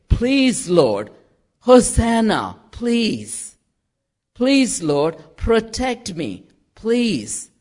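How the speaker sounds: high-pitched pleas, each falling away; imploring. Retold or imitated. People asking the Lord for protection, imploring. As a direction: beg in short separate bursts.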